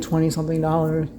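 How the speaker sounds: ordinary talk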